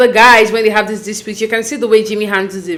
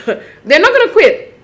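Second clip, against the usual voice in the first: amused laugh; 8 semitones higher, 4 dB louder, slower than usual. Real, not acted. Laughs at the couple's stubbornness.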